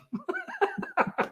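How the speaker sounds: high squeal laugh. Real, not acted. Laughing at chart joke.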